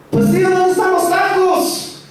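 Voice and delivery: high-pitched puzzled Spanish-speaking uncle; bewildered uncle hunting for food, high voice; confused uncle. Retold or imitated. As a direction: ask it baffled, way up high. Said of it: "he looks confused".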